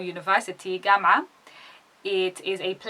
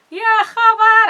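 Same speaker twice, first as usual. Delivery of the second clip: mock dismay; 12 dB louder than usual. Exclaims the phrase alone.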